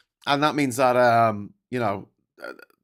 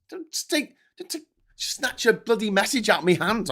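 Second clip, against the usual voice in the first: much higher, mock outraged; complaining the message was snatched away; mock indignant; 9 semitones higher than usual. Real, not acted. Message vanished from chat.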